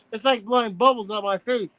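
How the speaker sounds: usual speaking voice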